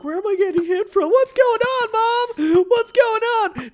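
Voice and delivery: high, whiny confused voice; mocking opponent. Gamer mocking his confused opponent, high whiny voice. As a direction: imitate the lost opponent calling for mom. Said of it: "He's like".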